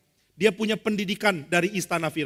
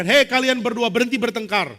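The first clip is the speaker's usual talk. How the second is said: loud, higher-pitched order to stop; commanding; 6 semitones higher, 6 dB louder than usual. Acted. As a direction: break up a fight with raised voice. Moses breaking up a quarrel. (perintah tegas dengan suara keras)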